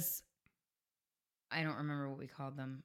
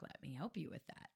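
quiet; 12 dB quieter than usual. Retold or imitated. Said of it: "and I'm like".

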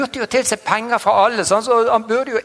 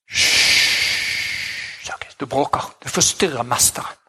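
hushing whisper; 5 semitones lower, slower than usual. Retold or imitated. Religious people hushing Zacchaeus.

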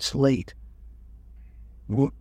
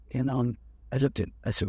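normal talking voice

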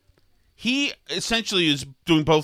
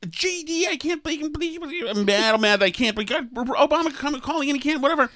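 high-pitched, flustered dream tantrum; mock upset; 9 semitones higher than usual. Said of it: "I'm upset in the dream".

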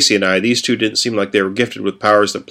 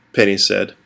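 usual speaking voice

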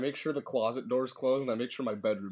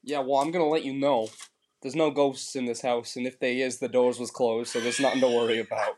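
put-on Boston accent; 4 dB louder than usual. Character speaks in Boston dialect.

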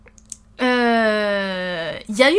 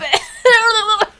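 shrieking laugh; 12 semitones higher, 8 dB louder than usual. Real, not acted. Laughing fit.

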